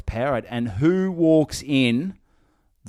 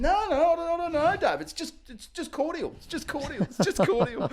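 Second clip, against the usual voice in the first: squeaky sheepish denial. Retold or imitated. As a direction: feign innocence in a tiny voice. Muggins denying, sheepish.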